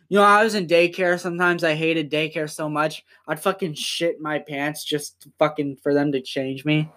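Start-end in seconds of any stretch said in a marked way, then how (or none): none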